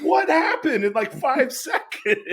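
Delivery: a stunned listener cracking up; baffled disbelief. Retold or imitated. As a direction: squeal out the confusion. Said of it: "I'm like".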